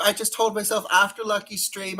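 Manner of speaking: normal talking voice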